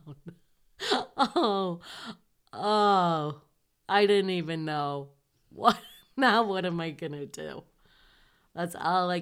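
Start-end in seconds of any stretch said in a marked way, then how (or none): 0.1-7.6 dismayed. Retold or imitated. Reacting to news of desertion.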